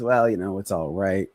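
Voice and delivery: a lightly acted glum answer, trailing off; low-energy reply. Shown as a demonstration. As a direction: play a tired prospect with low energy. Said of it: "you wanna listen for that, and you wanna match it".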